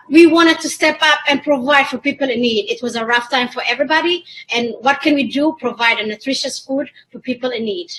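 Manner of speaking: ordinary talk, nothing marked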